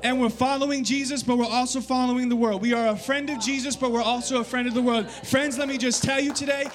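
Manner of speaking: high-pitched, urgent sermon delivery; ringing, insistent preacher's exhortation; impassioned preaching. Real, not acted. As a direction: press the congregation hard for a decision. Preaches at full force.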